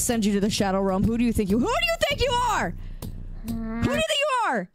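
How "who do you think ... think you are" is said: very high squeaky scolding voice; high angry character voice. Pretend. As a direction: scold like an angry cartoon critter.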